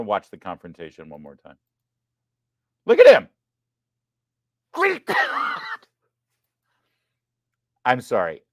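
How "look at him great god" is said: high, loud look at him then laughing; amazed laughing outcry. Real, not acted. Host replaying the kangaroo fight, laughing in awe. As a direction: shout it out in stunned laughter.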